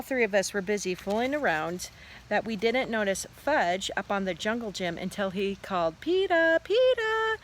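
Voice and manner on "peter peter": put-on high, loud little-kid call; toddler calling out. A narrator's reading. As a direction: call for big brother with toddler pronunciation. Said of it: "That's how he says my name".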